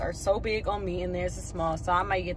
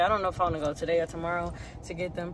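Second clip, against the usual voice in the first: ordinary delivery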